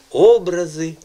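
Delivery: "образи" with a high, loud О; "образи" as Образи, first syllable strong; stress on first syllable. Shown as a demonstration. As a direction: punch the opening О of "образи". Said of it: "ще один наголос".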